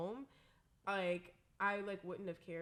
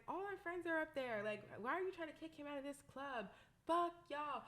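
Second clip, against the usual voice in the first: high, mock-indignant protest; retold angry yelling; 6 semitones higher than usual. Retelling her defense of a friend being kicked out, mock yell. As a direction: replay your outburst in a raised pitch, not volume. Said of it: "I start yelling back at them".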